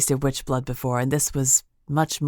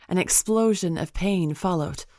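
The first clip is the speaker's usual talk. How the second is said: ordinary delivery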